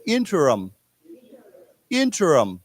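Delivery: INTERIM said alone with stress on IN, falling; model pronunciation. Shown as a demonstration. Teacher leading the class to repeat, clear model of INTERIM. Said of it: "say this word together".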